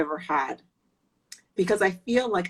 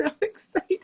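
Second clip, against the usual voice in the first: high-pitched giddy excitement; excited; 10 semitones higher, faster than usual. Real, not acted. Guest greets the author on the live, giddy with excitement. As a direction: laugh into it, thrilled and high. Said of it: "I'm so excited".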